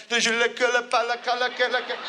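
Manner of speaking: high French gibberish, laughing; mock French. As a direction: fake French singing babble. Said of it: "they sing in French".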